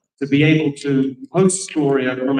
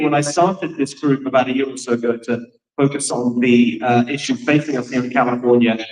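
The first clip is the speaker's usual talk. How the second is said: ordinary talk, nothing marked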